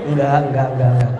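lightly voiced husband brushing off his wife. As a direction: wave her off as the husband.

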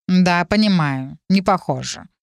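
slow, drawling cat; drawn out. Acted. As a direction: stretch the words lazily. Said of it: "протянул Морфеус". (протяжно)

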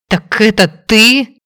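slow, stunned realization; stunned as it dawns; shocked exclamation. Acted. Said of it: "потрясённо воскликнула".

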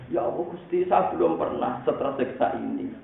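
high, hammed-up tearful complaint; mock weeping plea. Rabi'ah protesting her torment to God, mock-weeping. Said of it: "Wong nangis".

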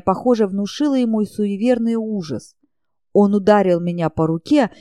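ordinary delivery, nothing marked

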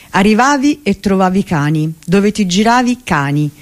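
usual speaking voice

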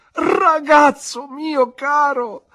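a nurse's overjoyed shout on seeing him; joyful cry. Acted. Peggotty recognises her grown boy, crying out with joy. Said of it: "gridò ella".

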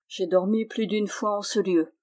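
usual speaking voice

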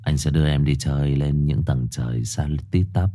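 low, cool bad-boy come-on; cold bad boy. Shown as a demonstration. Streamer demos bad-boy voice inviting Mai out, low and cold. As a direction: drop your voice deep and detached, playing the bad boy. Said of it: "Kiểu, kiểu trầm trầm, sau đó lạnh lùng nữa hả?".

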